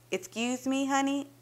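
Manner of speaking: high mock-polite wife voice; mocking imitation. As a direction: mimic her sweetly to ridicule her. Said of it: "It cracked me up".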